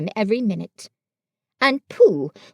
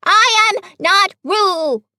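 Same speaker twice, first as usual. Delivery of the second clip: much higher, louder and slow; 10 semitones higher, 7 dB louder, slower than usual. A narrator's reading. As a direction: insist at full volume. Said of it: "said Piglet loudly".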